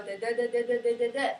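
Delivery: each "duh" a steady even beat; beat syllables. Shown as a demonstration. Teacher voicing the pattern students hear, as "duh" beats. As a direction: tap out the rhythm with "duh"s. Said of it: "That's what we hear".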